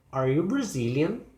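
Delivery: yes-or-no question climbing on "Brazilian"; rising question. Shown as a demonstration. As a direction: pitch lifts at the end of "Brazilian". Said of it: "Start with a yes or no question".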